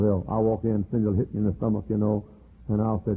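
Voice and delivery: normal talking voice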